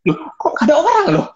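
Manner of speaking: quick, very high-pitched objection; incredulous protest. Retold or imitated. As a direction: imitate a baffled customer, voice shooting up. Acted protest at being turned away. (protes heran dengan nada tinggi)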